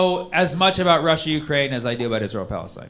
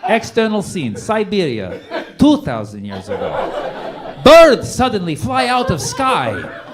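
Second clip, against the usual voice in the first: hammed-up broken English, louder and slow; mock Putin screenplay; 7 semitones higher, 7 dB louder, slower than usual. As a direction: narrate an epic like a self-important storyteller. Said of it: "He's like".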